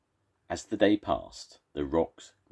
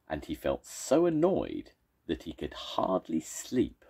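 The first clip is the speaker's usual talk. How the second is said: plain reading voice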